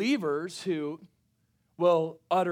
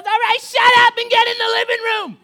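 much higher, louder mock mom; mom snapping; 12 dB louder than usual. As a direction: bark it like an impatient East Coast mom. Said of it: "So, so she goes".

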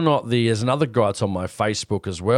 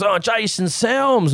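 much higher, slow, drawn-out name; delighted recognition; 10 semitones higher, much slower than usual. Retold or imitated. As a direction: savour the name like spotting a celebrity. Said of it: "He goes".

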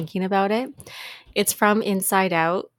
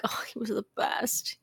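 fond whisper; 3 semitones higher, 9 dB quieter than usual. Real, not acted. Remembering beloved late dog.

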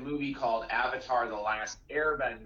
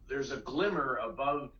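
ordinary talk

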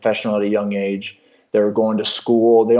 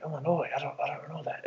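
Illinois asked back, softer and higher, puzzled; puzzled foreigner; 5 semitones higher, 12 dB quieter, faster than usual. Retold or imitated. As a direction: echo Illinois like a baffled stranger. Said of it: "And they're like".

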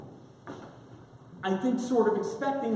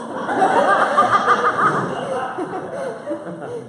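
long high laugh; big laugh; 13 semitones higher, 5 dB louder than usual. Real, not acted. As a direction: burst out laughing loudly. Laughing at his own voice.

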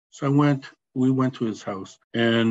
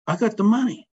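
retold friend bursting with excitement, much higher; excited news; 10 semitones higher than usual. Friend telling Teddy he got the loan, excited. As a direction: blurt it out like great news.

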